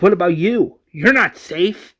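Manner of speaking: loud, high worried question; acted distressed insistence; alarmed concern. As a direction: raise the voice in urgent worry. Enid worries for wounded friend.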